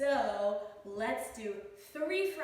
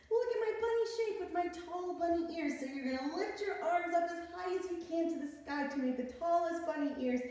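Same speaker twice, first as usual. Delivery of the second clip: ordinary talk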